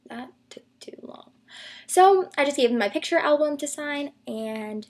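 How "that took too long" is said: whispered aside; whispering. Real, not acted. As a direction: drop to a whisper. Aside during story.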